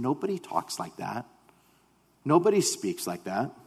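softly voiced, mildly awed quote; hushed marvelling. Listeners marvel at Jesus' words, hushed. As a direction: marvel under your breath, mildly.

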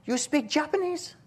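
put-on high-pitched Chinese-accented question; mock Chinese accent, high and rising. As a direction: imitate a Chinese lady quizzing a foreigner. Imitates Chinese lady questioning him.

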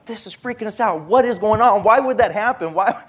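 scared cops shouting at Dan; panicked. Retold or imitated. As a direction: cops freaking out at the body. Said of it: "getting to a point of a panic".

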